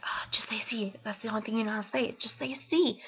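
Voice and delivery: hushed, quick inner whisper. Retold or imitated. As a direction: talk to yourself under your breath. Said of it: "in my head".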